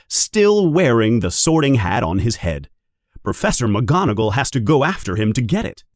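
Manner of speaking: ordinary delivery, nothing marked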